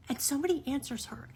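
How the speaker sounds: ordinary talk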